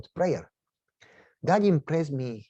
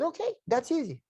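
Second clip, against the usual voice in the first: wry; 11 semitones higher, 4 dB quieter than usual. Retold or imitated. Wry reply to crying lady.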